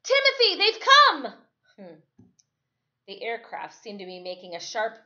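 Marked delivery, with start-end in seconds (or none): 0.0-1.5 high, loud shout across the beach; joyful yell. A narrator's reading. Boy yells to absent friend as plane passes, joyful shout. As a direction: yell it out, wild with hope. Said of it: "I yelled".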